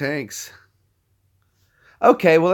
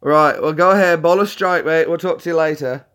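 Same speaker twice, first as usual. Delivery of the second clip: loud, higher British-mate sign-off; mock British accent, loud and chummy; Brad; 5 semitones higher, 7 dB louder than usual. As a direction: wrap up the call as a put-on British pal. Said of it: "I was doing Brad's voice".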